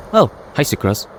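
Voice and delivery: ordinary talk, nothing marked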